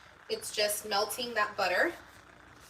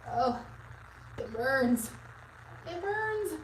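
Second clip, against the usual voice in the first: stinging eyes; 5 semitones higher, 4 dB quieter, slower than usual. Real, not acted. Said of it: "My eyes".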